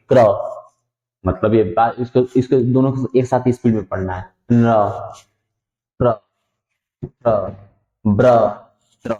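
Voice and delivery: क्र, प्र, फ्र, ब्र, त्र each said quickly as one syllable; teacher reading clusters. Shown as a demonstration. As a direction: drill the r-clusters crisply, one by one. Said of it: "क्या पढ़ेंगे". (हर संयुक्त अक्षर तुरंत एक साथ)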